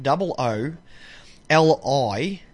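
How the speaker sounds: slow spelled-out letters; spelling slowly. Shown as a demonstration. Giving listeners the blog address, slowly spelled. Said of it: "hooley'sblog.blogspot.com".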